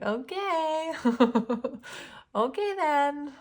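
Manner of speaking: high sing-out mock agreement with laughter; retold scoffing, giggly and high; amused mockery. Mocking churchgoers in retold reaction.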